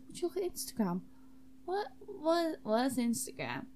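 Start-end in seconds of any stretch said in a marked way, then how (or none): none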